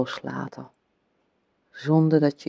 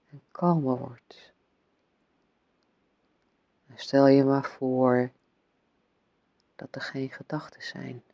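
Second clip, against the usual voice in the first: whispering. Acted. Calming guidance, whispered fading.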